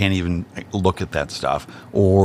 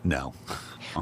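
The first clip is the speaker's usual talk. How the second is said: whispered uh-huh; 5 semitones lower, 13 dB quieter than usual. Real, not acted. Teasing refusal.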